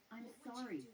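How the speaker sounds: sorry forced out through a tight throat; choked up. A narrator's reading. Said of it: "he choked out".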